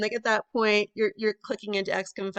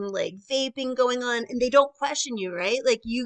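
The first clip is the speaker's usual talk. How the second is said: ordinary delivery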